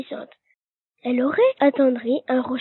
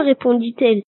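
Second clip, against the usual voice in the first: ordinary delivery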